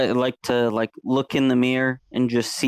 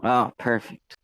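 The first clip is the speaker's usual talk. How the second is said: normal talking voice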